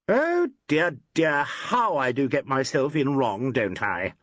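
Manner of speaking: a sugary mock apology; cooed. A narrator's reading. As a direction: coo it with false sweetness. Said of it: "cooed Ackroyd".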